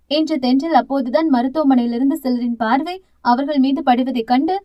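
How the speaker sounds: usual speaking voice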